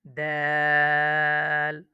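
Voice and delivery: দাল with the vowel held very long and level; দাল, drawn out, very slow; long stretch. Shown as a demonstration. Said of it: "দাল লম্বা টান হবে". (দাল লম্বা টেনে উচ্চারণ)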